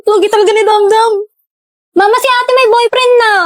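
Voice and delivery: loud, high little sibling teasing; a bratty kid shouting to tell on her sister; tattling kid. Acted. Little sibling loudly telling mama about sister's boyfriend, taunting.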